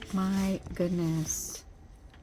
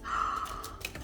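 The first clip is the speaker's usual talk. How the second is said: sharp breathy gasp of delight; awed gasp; 5 dB quieter than usual. Real, not acted. Unboxing new polish, gasping in delight. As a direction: gasp at the pretty colors.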